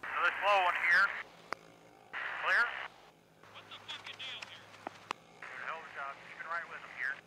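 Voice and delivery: distant radio calls to the driver; race spotter radio. Real, not acted. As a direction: spotter updates over the radio. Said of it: "that session of Crank It Up".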